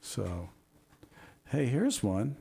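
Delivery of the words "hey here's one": normal talking voice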